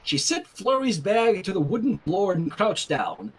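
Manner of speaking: ordinary delivery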